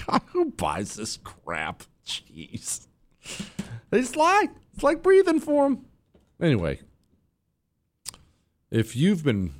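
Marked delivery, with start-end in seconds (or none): 0.1-2.9 disgusted aside. Real, not acted. Disgusted aside after parody. 3.9-5.9 much higher falsetto parody of a tearful woman; mock weepy woman. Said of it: "she sounds like it".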